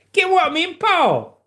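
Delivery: high, loud Scottish shout; Scottish accent. Acted. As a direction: holler like an outraged comic Scot. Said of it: "Hot as Hell here is Scottish".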